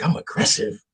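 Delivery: whispered disbelief; hushed mock surprise at the accusation; incredulous whisper. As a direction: breathe it out in disbelief. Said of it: "I'm like".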